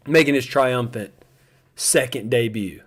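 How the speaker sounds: usual speaking voice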